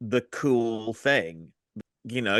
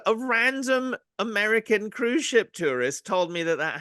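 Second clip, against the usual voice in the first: high-pitched, incredulous comeback; mock-indignant protest; 11 semitones higher than usual. Real, not acted. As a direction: argue back as if outraged. Arguing the claim is true.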